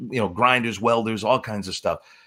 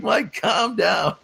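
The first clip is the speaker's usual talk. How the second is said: high, drawn-out plea through laughter; laughing retold plea; 8 semitones higher, much slower than usual. Friend calming a raging wrestler, laughing retold plea. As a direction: laugh while imitating your own pleading.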